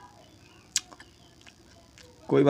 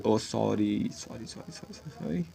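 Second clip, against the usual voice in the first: mock condolence; 11 dB quieter than usual. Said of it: "मैं उसके लिए सॉरी बोल रहा हूँ".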